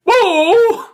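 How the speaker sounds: high, loud startled pigeon; pigeon whoa. Acted. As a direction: yelp it like a spooked pigeon. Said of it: "He says".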